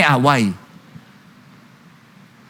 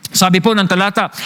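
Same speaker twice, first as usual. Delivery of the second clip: normal talking voice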